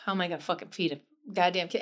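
normal talking voice

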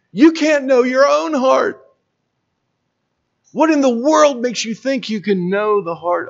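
fervent preacher rising to the climax; preaching at full force. Acted. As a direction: climb up and drive the rebuke home.

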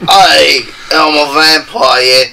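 slow, booming mock English vampire hunter; lightly hammed deep English accent. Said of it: "talk like a deep English accent".

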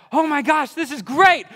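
loud, high, thrilled squeal; thrilled kid. Retold or imitated. Kid opening a gift he loves, thrilled. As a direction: gush like a kid overjoyed by a gift.

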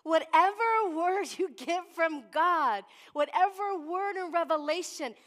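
fervent, emphatic sermon build; preaching. Acted. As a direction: drive the promise home to the congregation. Preaches at full force.

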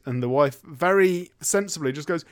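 ordinary talk, nothing marked

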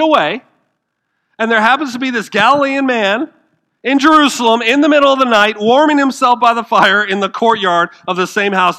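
high, loud driving sermon voice; forceful preaching. Acted. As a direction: preach it hard to the crowd.

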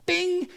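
high bright bing like a bell; a sudden ding of inspiration; playful bing. Retold or imitated. Holy Spirit nudging the pastor, a high mock bing. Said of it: "the Holy Spirit just goes".

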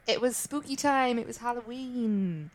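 usual speaking voice

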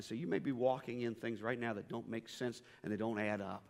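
ordinary delivery

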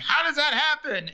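high-pitched baffled question, acted out; retold incredulous cry; mock disbelief. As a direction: imitate a guest who can't believe it. Voicing baffled party guests.

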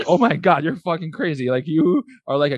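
high, loud mock disbelief; shocked outburst. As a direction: act stunned at her answer. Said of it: "it was like".

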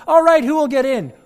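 high, loud call for a volunteer; showman's call. Acted. Daredevil asks who will ride, loud call. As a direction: challenge them to step up.

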